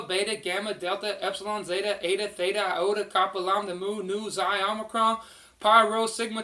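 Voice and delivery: ordinary delivery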